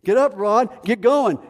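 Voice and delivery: a friend pushing him to get going; urging friend. Retold or imitated. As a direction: friend calling out to Rod to get up.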